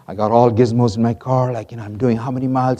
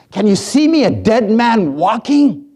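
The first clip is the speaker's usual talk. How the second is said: leper crying out; 9 semitones higher, 6 dB louder than usual. Acted. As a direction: cry it out, stretching the last words. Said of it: "calling out to Jesus".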